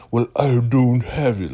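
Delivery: high, loud sing-song mimicry; mocking. Elder Mother making fun of Ruth in the kitchen, loudly mocking. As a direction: make fun of her with an exaggerated put-on voice. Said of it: "She's mocking Ruth, right? Really making fun of her".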